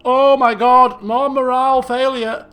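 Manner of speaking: loud real exasperation; real dismay. Real, not acted. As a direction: cry out in dismay. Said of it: "player reacts loudly".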